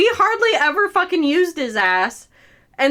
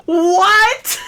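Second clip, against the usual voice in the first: incredulous shout; 12 semitones higher than usual. Retold or imitated. Retelling friend's flirting, shocked high-pitched yelling. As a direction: yelp it out in shocked outrage.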